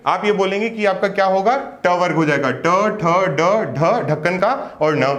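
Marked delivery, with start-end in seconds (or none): none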